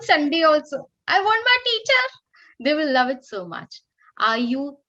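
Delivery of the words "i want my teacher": put-on eager child. Children begging for their teacher.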